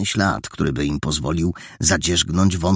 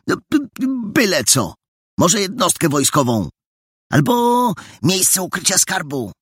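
put-on flustered boy; 13 semitones higher than usual. Boy flustered, defends code theory.

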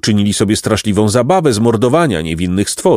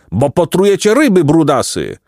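higher-pitched irritated rebuke; indignant scolding; 7 semitones higher than usual. A narrator's reading. Beaver scolds dirty boys for poisoning fish, mild anger.

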